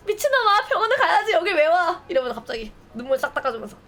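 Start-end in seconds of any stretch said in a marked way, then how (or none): 0.1-2.0 crying shout. Pretend. To the bloodied hero who came anyway, a crying shout. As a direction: scream at him through tears. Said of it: "내가 울면서".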